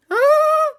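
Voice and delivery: shrill frightened shriek; high scream. Acted. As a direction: scream in terror. Kids scream.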